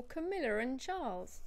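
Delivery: "Camilla and Charles" with an R after "Camilla"; intrusive R. Shown as a demonstration. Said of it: "what's called an intrusive R".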